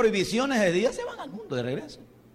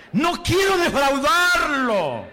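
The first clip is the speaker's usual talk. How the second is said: higher, louder and slow No quiero defraudarlo, dwelling on quiero; fervent plea; 6 semitones higher, 5 dB louder, slower than usual. Shown as a demonstration. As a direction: model the vow for the congregation to repeat.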